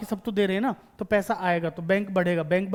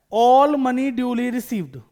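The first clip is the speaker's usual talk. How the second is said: very slow, louder, word by word; slow reading aloud; 2 semitones higher, 6 dB louder, much slower than usual. Shown as a demonstration. Teacher reading the question to students, very slow and louder. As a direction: read each word out slowly and clearly. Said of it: "मैं question को आराम आराम से पढ़ रहा हूँ".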